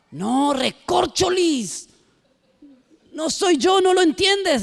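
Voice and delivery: Peter's denial squeezed up high and rising; changed voice. Acted. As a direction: deny it in a strained high voice. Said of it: "hasta cambió la forma de hablar".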